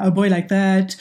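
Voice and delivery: put-on light Puerto Rican accent, sung-out West Side Story line; a hint of Anita's Puerto Rican accent. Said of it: "perfecting my Puerto Rican accent".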